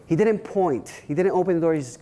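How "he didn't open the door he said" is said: normal talking voice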